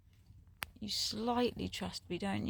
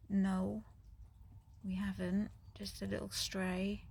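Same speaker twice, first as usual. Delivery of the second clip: normal talking voice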